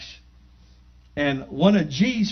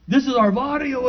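a hint of homeboy swagger, pitched up; imitating homeboy; 6 semitones higher than usual. Imitated gang member claims turf.

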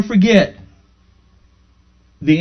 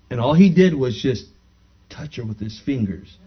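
ordinary talk

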